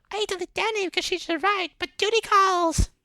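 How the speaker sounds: very high, light put-on voice of the mom; mom character voice. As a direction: squeak it out like a fussy mother.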